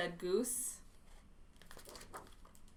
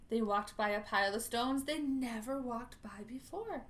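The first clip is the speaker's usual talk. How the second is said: usual speaking voice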